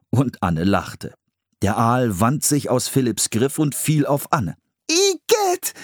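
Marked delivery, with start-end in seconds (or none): 4.9-5.7 very high, shrieked Igitt; startled yuck. A narrator's reading. Anne as the eel falls on her, high frightened yuck. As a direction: recoil from a slimy eel. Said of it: "schrie sie erschrocken".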